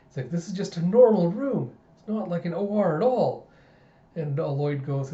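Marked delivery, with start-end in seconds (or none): 0.3-3.5 put-on higher, louder kid complaint; underwhelmed child. Child sees papa's ordinary consultation room, let down. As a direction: protest in a raised child voice.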